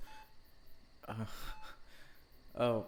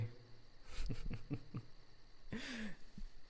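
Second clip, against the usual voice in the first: breathy evil laugh; 11 semitones higher, 9 dB quieter than usual. Acted. Torturer laughs.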